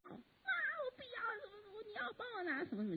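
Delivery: imitating a little girl crying on the floor; crying child. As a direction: wail like a tantrum toddler. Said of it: "哭 叫".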